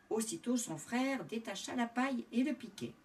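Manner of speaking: plain reading voice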